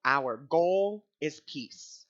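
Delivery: slow, deliberate, every word weighed; slow declaration. Retold or imitated. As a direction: lay it down calmly like a prayer. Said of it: "and so I said it out loud".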